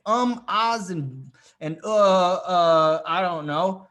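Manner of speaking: drawn-out filler sounds, loud uhs; acted hesitation. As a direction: stall on ums and uhs, mock fumbling. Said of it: "if you say".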